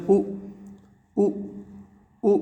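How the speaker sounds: "उ" said as a very short u; clipped short "उ", one at a time; short vowel demonstration. Shown as a demonstration. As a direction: sound out each "उ" briefly, with pauses between. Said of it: "इसको हम कैसे उच्चारण होता है?".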